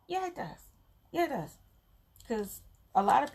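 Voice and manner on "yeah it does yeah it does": playful insistence. Real, not acted. Answering imagined viewer.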